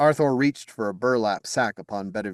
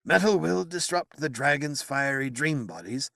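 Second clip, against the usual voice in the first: grudging admission; 6 semitones higher than usual. Acted. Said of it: "Merlin admitted, glowering with consternation".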